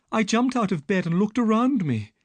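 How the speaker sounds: put-on frightened young woman telling her story; Miss Stoner.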